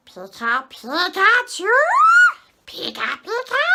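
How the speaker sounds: high, squeaky creature cries leaping upward; Pikachu. Pretend. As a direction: chirp it loudly like an excited little cartoon creature. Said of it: "and I can do Pikachu".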